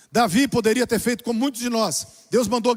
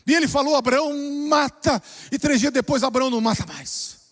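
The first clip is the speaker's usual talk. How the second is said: preaching at full force; 6 semitones higher than usual. Acted. Pastor exhorting the church about the throne, full-force preaching. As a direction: preach it to the church, raised and ringing.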